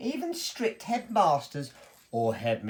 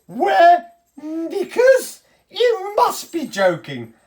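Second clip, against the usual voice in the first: high, loud, slow laughing indignation; outraged disbelief; 11 semitones higher, 8 dB louder, much slower than usual. Acted. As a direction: squeal at the absurd idea. Emperor outraged at bloomers offer.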